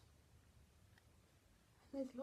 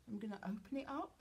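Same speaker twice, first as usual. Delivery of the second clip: usual speaking voice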